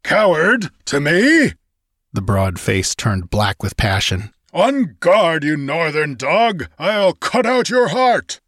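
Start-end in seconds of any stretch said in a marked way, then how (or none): 0.0-1.5 outraged. A narrator's reading. Giant outraged at insult. 4.5-8.4 furious challenge. A narrator's reading. Giant challenges Conan to fight.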